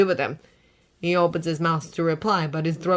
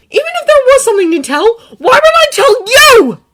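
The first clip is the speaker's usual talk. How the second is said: defiant; 15 dB louder than usual. A narrator's reading. Izuku's thought voiced.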